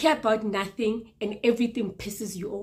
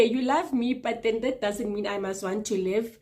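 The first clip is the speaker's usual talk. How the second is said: ordinary delivery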